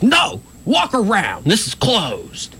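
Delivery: high, drawn-out mock command; yelled order. Storyteller imitating officer refusing a hand over the barricade, yelling.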